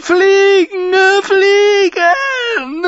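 high screeching cry, drawn out; shrieking boy. A narrator's reading. Robert shrieking at the hall, crying and screeching. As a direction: scream it through tears like a fit is coming. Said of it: "kreischte er".

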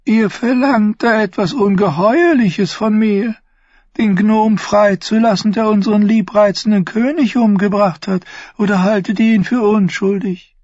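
Varys gasps high and startled; gasped. Acted. Varys' hohe Stimme. (gekeucht, hoch und erschrocken)

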